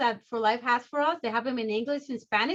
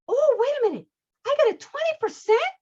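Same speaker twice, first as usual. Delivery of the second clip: much higher, louder, delighted exclamation; thrilled surprise; 11 semitones higher, 4 dB louder than usual. Acted. Customer scratching off a discount, thrilled surprise. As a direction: act out amazed discovery of a prize.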